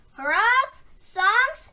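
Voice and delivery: ordinary delivery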